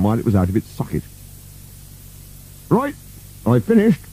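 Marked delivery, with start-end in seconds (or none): 2.7-4.0 high-pitched servant voice; Burke. A narrator's reading. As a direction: announce it in a high character voice. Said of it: "said Burke".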